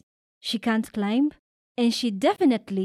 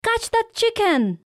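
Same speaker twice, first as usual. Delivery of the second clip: much higher excited call; shout; 13 semitones higher, 4 dB louder than usual. A narrator's reading. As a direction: yell it out to Lami. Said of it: "shouts friend Fatima".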